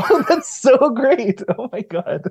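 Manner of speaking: high, slow, giggling gush; delighted laughing. Retold or imitated. Reacting with joy to hearing Greek in the game, laughing. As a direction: laugh through the words with delight. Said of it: "And it's like".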